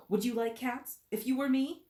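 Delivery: high, loud outraged question; shrill passionate. Acted. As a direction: fling the question back in outrage. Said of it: "cried the mouse in a shrill, passionate voice".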